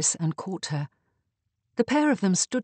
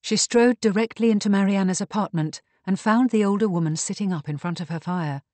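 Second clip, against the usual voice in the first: usual speaking voice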